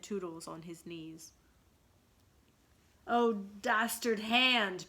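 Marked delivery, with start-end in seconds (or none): 3.1-4.9 slow, louder, melodramatic outcry; theatrical lament. A narrator's reading. Peter condemning the guilty hand, melodramatic slow lament. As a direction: ham up the tragic hero. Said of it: "Peter said".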